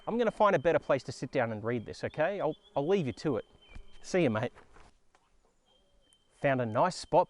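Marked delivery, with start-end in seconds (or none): none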